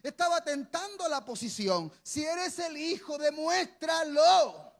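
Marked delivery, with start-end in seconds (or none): 2.0-4.7 devil's taunt, slow and shouted; mocking taunt. Preacher voicing the devil daring Jesus, slow shouted taunt. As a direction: dare him with a drawn-out shout.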